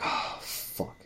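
breathy whispered oh fuck; hushed, voiceless muttered curse. Retold or imitated. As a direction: whisper it under your breath. Quiet reaction in the car.

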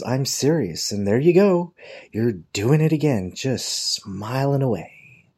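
mock her sarcasm, stretching the last phrase; sarcastic secretary. Secretary catches coworker smiling again, sarcastic scolding. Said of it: "And she says".

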